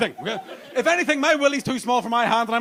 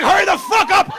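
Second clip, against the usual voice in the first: higher, louder mock shout; impatient yell; 9 semitones higher, 9 dB louder than usual. Girlfriend yelling at him to hurry, mock shout. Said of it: "She's like".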